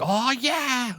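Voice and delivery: high, mock movie-goer cheer; a lone fan cheering at the screen, high and excited. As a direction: imitate one guy cheering in the theater. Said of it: "one guy was like".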